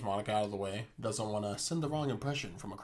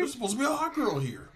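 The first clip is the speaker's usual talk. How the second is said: high mock Joey whine; 14 semitones higher, 4 dB louder than usual. Voicing Joey's complaint.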